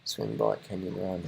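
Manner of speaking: soft breathy whisper of the slogan. Shown as a demonstration. Repeating the race slogan to himself in a whisper. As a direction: whisper it to yourself, almost silent.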